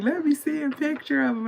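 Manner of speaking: high-pitched imitation of a laughing friend; mimicked high, tickled voice; Rufus laughing. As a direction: squeal it high, as if cracking up. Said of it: "he starts crying, laughing".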